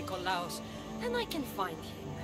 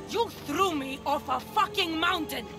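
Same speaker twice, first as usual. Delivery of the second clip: louder, higher burst of fury; angry accusation; 6 semitones higher, 5 dB louder than usual. Acted. Accusing a sibling of throwing you off a mountain, angry outburst. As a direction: hurl the accusation, hitting it hard.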